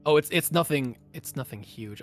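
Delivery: ordinary talk, nothing marked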